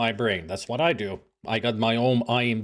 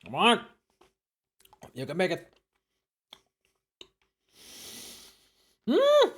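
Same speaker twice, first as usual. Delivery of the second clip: eating sour candy; 6 semitones higher than usual. Real, not acted. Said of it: "sour candy ordeal".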